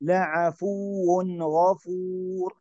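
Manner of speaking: measured recitation, no hum on "لعفو"; correct direct reading. Shown as a demonstration. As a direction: go straight from "لعفو" into "غفور". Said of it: "مباشرة".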